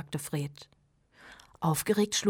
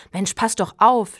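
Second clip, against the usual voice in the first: loud angry scolding; 3 semitones higher, 12 dB louder than usual. A narrator's reading. Fred scolding Steve who nearly fell in, loud. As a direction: snap at him to watch out. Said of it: "schimpfte er".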